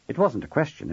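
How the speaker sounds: ordinary delivery, nothing marked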